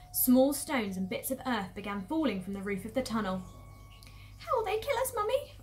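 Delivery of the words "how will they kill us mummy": high little cub voice; scared youngster, pitched way up; frightened fox child. Acted. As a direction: ask Mummy in a small, high voice. Small fox, frightened, asks mother.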